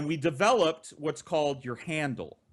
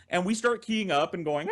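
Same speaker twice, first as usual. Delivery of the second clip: usual speaking voice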